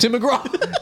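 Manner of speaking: high, laughing echo of the name; laughing repeat. Real, not acted. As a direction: tease the slip through a laugh. Mocking repeat of slip.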